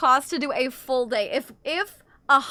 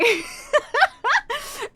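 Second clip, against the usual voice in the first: high burst of laughter; amused laugh; 12 semitones higher, 3 dB louder than usual. Real, not acted. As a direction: laugh at your own idea. Laughs at own reverse idea.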